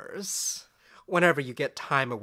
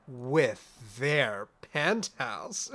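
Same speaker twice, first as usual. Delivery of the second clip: usual speaking voice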